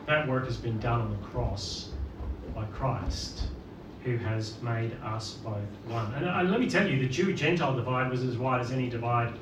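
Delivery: normal talking voice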